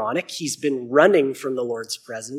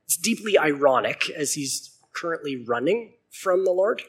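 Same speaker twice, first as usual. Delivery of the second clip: usual speaking voice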